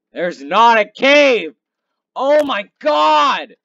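loud, high, slow cry of despair; exasperated shout. Real, not acted. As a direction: wail it out in disbelief. Despair finding no cave.

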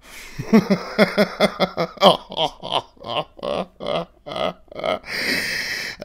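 long high sneering laugh; mocking laugh. Mirror self mocking Mars, long sneering laugh. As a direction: laugh down at him.

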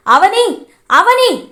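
angry husband bellowing his wife's name; loud calling shout. Acted. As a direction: yell for Avani, angry and loud. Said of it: "అని గట్టిగా అరుస్తాడు".

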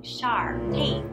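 plain reading voice

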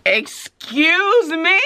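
slow, high-rising indignant question; affronted disbelief. Retold or imitated. Teacher reacts to screaming student.

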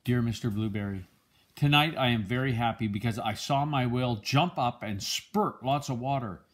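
usual speaking voice

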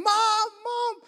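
high, drawn-out cry for mother; imitated child's scream. Frightened boy screaming for his mother in a retold story, imitated scream. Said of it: "And he starts screaming".

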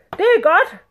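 high, loud royal pronouncement; imperious sultan. A narrator's reading. Sultan approving his general's answer, imperious. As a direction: declare it in a big sultan voice.

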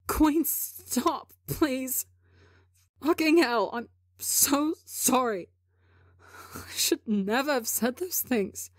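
high, pleading through tears; tearful pleading. Acted. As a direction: beg him to believe you, crying. Tebo pleading while crying.

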